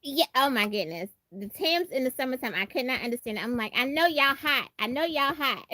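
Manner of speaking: normal talking voice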